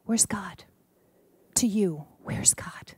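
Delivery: put-on hushed whisper asking the children. Minister whispering to the children. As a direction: lean in and whisper the question.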